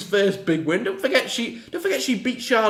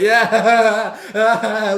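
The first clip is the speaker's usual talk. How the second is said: smug yeah; 4 semitones higher, 7 dB louder than usual. Retold or imitated. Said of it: "looking all smug".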